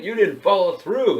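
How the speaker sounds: high, loud imitation of a complaint; yelling customer. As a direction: yell it like an aggrieved customer. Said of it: "yell at the girls".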